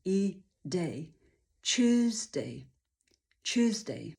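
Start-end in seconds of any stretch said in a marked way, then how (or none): 1.8-4.1 "Tuesday" built from German tschüss and day; tschüss plus day. Shown as a demonstration. Teacher shows personal choice, Tuesday as tschüss-day. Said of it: "Ja osobiście używam niemieckie tschüss i day".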